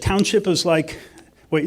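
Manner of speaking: ordinary delivery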